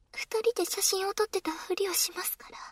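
secretive whisper. Acted. Kouhai whispering a cover story to her senpai. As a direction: whisper so no one nearby can hear.

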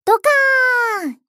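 slow, loud shouted boom; explosion sound. Pretend. Explosion voiced in play.

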